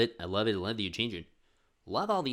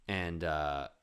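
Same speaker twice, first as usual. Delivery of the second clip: ordinary talk, nothing marked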